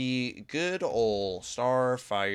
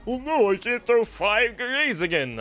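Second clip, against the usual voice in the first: higher mocking complaint; higher sarcastic mock complaint; mock whine; 10 semitones higher, 3 dB louder than usual. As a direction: parody the whiners. Mocking complainers about a nerf.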